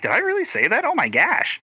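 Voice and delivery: high-pitched mock dismay; embarrassed disbelief. She catches her own accent slipping, high embarrassed disbelief. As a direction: squeal it lightly, slowing at the end. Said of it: "I'm like".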